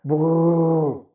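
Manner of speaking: slow rolling brrr with closed lips; lip trill. Shown as a demonstration. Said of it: "with closed lips".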